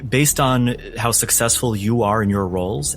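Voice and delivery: ordinary delivery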